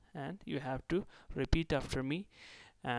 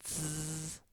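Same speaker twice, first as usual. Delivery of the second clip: long, steady "zzz" buzz; vibrating buzz; 2 semitones higher, 5 dB quieter than usual. Shown as a demonstration. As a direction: demonstrate a sustained vibrating z. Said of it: "bone vibrate".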